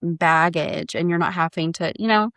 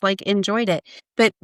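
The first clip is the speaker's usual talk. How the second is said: ordinary delivery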